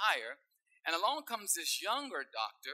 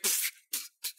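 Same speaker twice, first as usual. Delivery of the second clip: unvoiced spitting pfft noises; spitting sputter. Young doctor tasting gasoline, sputtering spit noises. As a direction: spit the drink out in disgust.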